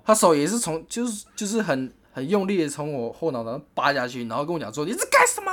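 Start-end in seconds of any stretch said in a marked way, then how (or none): 4.9-5.5 high, sharp mock yell at him; a shocked girlfriend's yell, much higher; retold yell. Ex-girlfriend yells at him over dropped pizza, high mock yell. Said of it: "他只要一吼出来".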